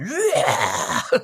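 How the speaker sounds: high shouted hardcore vocal burst; imitated vocal yell. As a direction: belt out a singer's opening yell. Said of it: "the way the singer comes in and goes".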